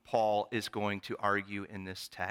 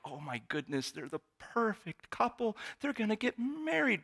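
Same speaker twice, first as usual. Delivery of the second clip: gushing gossip; 10 semitones higher than usual. Retold or imitated. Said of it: "everybody was like".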